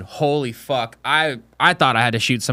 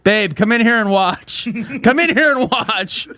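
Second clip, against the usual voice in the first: higher laughing call; laughing call to babe; 7 semitones higher than usual. Pretend. Husband calling his wife to watch, laughing. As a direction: invite her in, giggling. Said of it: "calling wife to watch".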